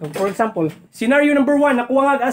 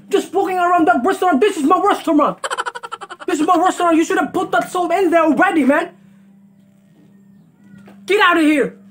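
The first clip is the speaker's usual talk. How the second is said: higher, ranting mock chef; Gordon Ramsay impression; 6 semitones higher than usual. Vlogger as the chef firing the cook, high hammed-up yell. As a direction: fire the cook in a hammed-up rage. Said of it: "Have you already put the salt".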